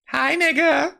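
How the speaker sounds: very high, cutesy mock greeting; creepily smitten. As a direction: imitate an overly sweet hello, way up high. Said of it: "looking at him like".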